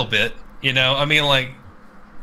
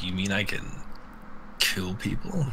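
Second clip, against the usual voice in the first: hushed, low-voiced gleeful question; mock-innocent hushed wonder; quiet mock disbelief; 5 semitones lower, 9 dB quieter than usual. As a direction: ask it softly like a secret delight. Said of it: "I'm like".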